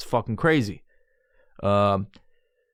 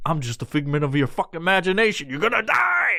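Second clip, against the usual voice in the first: menacing figment; 6 semitones higher than usual. Retold or imitated. Imaginary friend turning on the stoner, menacing. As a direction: pitch climbing to a high finish. Imaginary figure threatens him.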